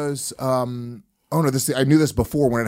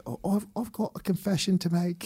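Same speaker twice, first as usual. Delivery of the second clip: higher, softer put-on British accent; fake British accent; 6 semitones higher, 9 dB quieter than usual. Girl confesses to him at sunrise, fake British accent. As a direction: imitate a hesitant girl faking British. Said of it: "faked the British accent".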